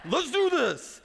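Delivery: put-on pumped-up kid. Son heads up the slide tower.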